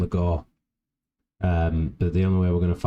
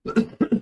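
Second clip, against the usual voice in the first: a rough, chesty cough. Real, not acted. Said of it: "sorry for coughing every two minutes".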